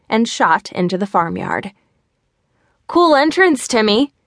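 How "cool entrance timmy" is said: Mikey calls out loud and high across the yard; a friendly shout from the porch; calling out. A narrator's reading. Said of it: "Mikey called from".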